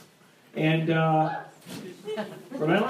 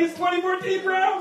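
mock teen brother; 9 semitones higher than usual. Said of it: "He's like".

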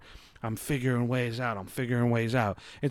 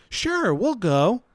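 forced agreeable reply; 10 semitones higher, much slower than usual. Retold or imitated. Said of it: "But my mouth went".

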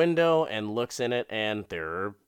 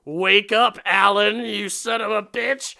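furious yell; 9 semitones higher, 7 dB louder than usual. Retold or imitated. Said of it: "She's like".

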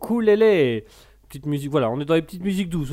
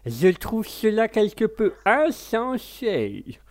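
put-on posh English accent; 7 semitones higher, slower than usual. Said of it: "L'anglais avec le côté comme ça".

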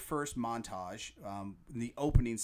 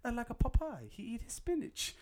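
put-on much higher, lightly Russian-accented; her accent; 13 semitones higher than usual. Fan imitating the Russian wife, light Russian accent. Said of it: "how she goes".

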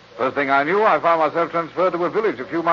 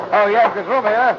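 calling out; 8 semitones higher, 5 dB louder than usual. Acted. Calling the American into compartment.

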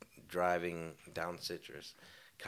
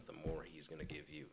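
whispered aside; 10 dB quieter, faster than usual. Real, not acted. Whispered punchline about giving to God.

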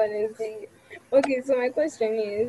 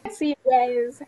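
cheerful sign-off; 7 semitones higher, slower than usual. Acted. Outro sign-off.

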